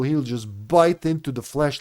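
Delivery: ordinary delivery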